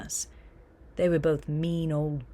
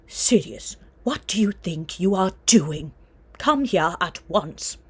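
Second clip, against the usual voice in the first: a severe witch snapping loudly at her son; mother scolding her boy across the platform, loud and stern; stern mother calling; 7 dB louder than usual. Acted. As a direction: call him back sharply, loud and stern.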